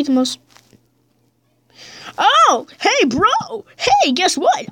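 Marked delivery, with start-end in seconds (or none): 2.2-4.7 high, eager call; excited. Real, not acted. Gamer tells his friend excitedly. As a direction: burst with the news.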